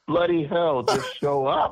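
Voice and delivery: amused, offhand, through a chuckle; laughing reply. Retold or imitated. [name] telling [name] to just come, laughing.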